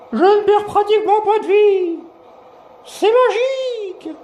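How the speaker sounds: very high squeaky gloat; squeaky cartoon voice.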